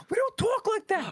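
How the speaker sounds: New York lady yelling at the guy, hammed-up shout. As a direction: blast the repeated line as a mock shout. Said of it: "she's like".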